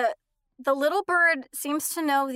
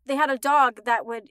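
ordinary delivery

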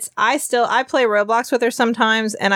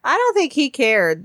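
ordinary talk

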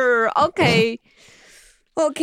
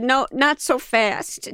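slow quote; slower than usual. Retold or imitated. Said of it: "and you said".

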